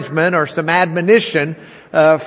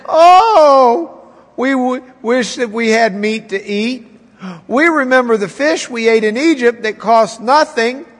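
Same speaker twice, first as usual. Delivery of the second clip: mock whining, starting high; whining Israelites; 4 semitones higher than usual. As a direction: complain like spoiled people. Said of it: "They start crying".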